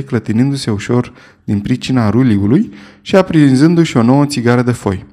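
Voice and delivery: ordinary delivery, nothing marked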